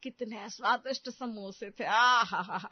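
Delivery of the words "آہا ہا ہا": a loud, relishing "aha ha ha"; delighted laugh. Acted. Mother-in-law boasting to her friends, laughing with delight. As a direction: laugh out with delight over the tasty samosas. Savoring the bahu's samosas.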